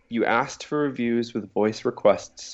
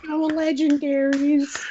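squeaky rules lawyer; high nerd voice; slower than usual. Acted. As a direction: insist on the rule in a high voice.

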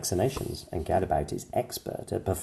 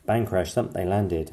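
usual speaking voice

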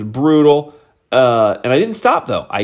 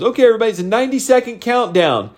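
higher-pitched event announcer call; loudspeaker announcer; 7 semitones higher, much faster than usual. Retold or imitated. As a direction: imitate a race announcer addressing the crowd. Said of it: "the announcer guy said, through his loudspeaker".

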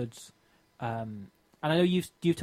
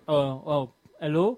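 put-on hesitant hello in a light French accent; toned-down French accent; 3 dB louder than usual. Answering the pizza guy's call, subtle French accent. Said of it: "I'm still managing the French accent".

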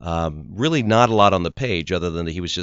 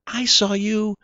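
excited fan; 10 semitones higher than usual. Retold or imitated. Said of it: "people come up to me in airports and say".

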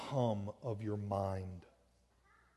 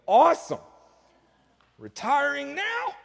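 high, comic squeak of dismay; mock enthusiasm; 14 semitones higher, 7 dB louder than usual. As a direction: feign delight, then bail out in a high voice. Acts out his dismayed joking reaction.

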